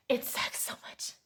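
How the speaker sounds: a hushed, exasperated aside; frustrated whisper. Real, not acted. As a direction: whisper it under your breath, fed up. Venting frustration, then repeats louder.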